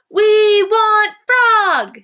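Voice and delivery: very high, loud, slow excited chant; excited crowd. Shown as a demonstration. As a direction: rouse the whole crowd. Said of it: "I bet they're really excited".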